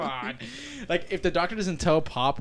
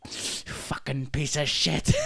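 mock grumble; 3 dB quieter, much slower than usual. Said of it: "shaking his head like".

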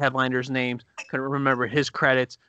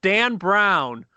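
mild mock call-out, higher pitched; retold yell; 6 semitones higher, 4 dB louder than usual. Mom correcting the host from the crowd, mildly imitated yell. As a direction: call the name out without a full shout. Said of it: "my mom yells out".

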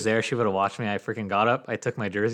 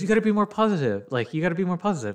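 imitating the girlfriend, higher pitched; girlfriend's voice; 9 semitones higher than usual. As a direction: mimic her nagging him to cheer up, pitched up. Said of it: "And she goes".

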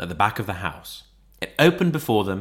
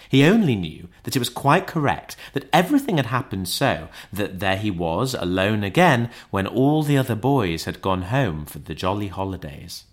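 ordinary delivery

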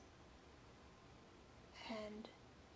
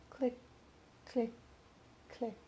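clicking heels; 5 dB quieter than usual. Acted. Heels walking away down hall.

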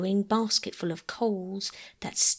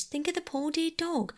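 high, pleading and pitying; 9 semitones higher than usual. A narrator's reading. Phyllis begs the others to pity the dog, high and pleading. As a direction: plead for the poor dog, voice lifted. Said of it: "cried Phyllis".

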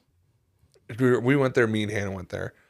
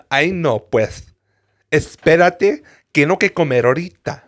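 Mexican telenovela Spanish, louder and higher; overacted telenovela Spanish; telenovela acting; 6 semitones higher, 6 dB louder than usual. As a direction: ham it up like a bad telenovela actress. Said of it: "the terrible acting inflection".

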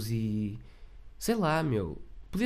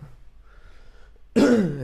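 sick cough; 7 semitones higher, 9 dB louder than usual. Real, not acted. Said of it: "o doente [name]".

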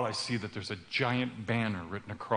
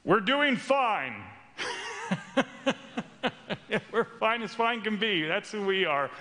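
fake cheerful man; 7 semitones higher than usual. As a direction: insist everything's fine, too brightly. Masked man insists all is fine.

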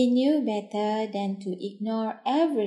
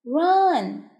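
raised, higher storybook shout; 4 semitones higher, 5 dB louder than usual. A narrator's reading. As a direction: call out a warning in a louder voice. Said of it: "shouted as loud as he could".

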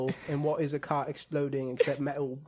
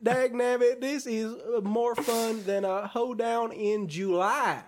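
hammed-up Southern cowboy; 9 semitones higher, 6 dB louder than usual. Podcaster clowning as a folksy cowboy, hammed-up Southern accent. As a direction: whoop it up like a cartoon Southern cowpoke.

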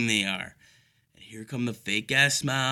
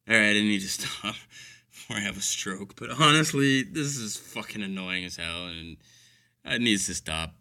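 usual speaking voice

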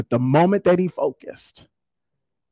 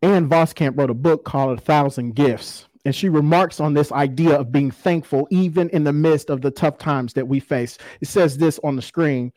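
ordinary talk, nothing marked

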